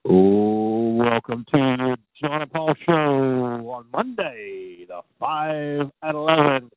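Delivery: drawn-out words in a live show welcome; show-opening announcer. Acted. Host to listeners opening the Monday show, announcer style.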